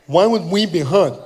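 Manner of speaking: put-on loud deaf-accented retort; puzzled protest in deaf speech; imitated deaf athlete. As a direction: mimic a baffled deaf man objecting. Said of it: "he was partially deaf".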